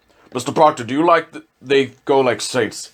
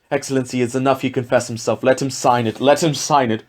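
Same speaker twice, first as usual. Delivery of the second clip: ordinary talk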